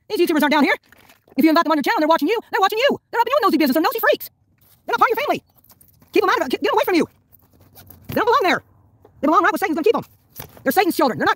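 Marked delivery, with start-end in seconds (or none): 1.4-10.1 high, heated warning about snoops; high-pitched rant. Real, not acted. Woman warning her viewers about nosy YouTubers, heated and high. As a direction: warn them off, voice climbing high.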